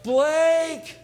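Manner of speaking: high, loud calling out across a lot; joyful call. Retold or imitated. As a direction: call the grandkids from afar. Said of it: "I go".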